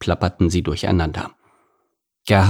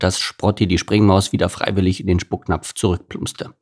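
ordinary delivery, nothing marked

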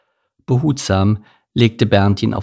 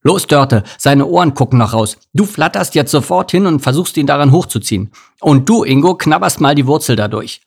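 raised, forceful rescue commands; bossy bear rallying the helpers; urgent orders; 5 semitones higher, 5 dB louder than usual. A narrator's reading. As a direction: take charge, loud and insistent. Bear takes charge of the rescue.

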